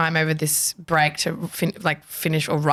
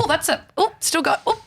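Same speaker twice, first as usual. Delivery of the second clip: nervous squeak; 11 semitones higher than usual. Retold or imitated. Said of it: "You're like".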